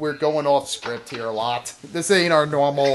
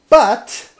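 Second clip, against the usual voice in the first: excited; 6 semitones higher, 6 dB louder, slower than usual. Real, not acted. Excited announcing a celebrity comment.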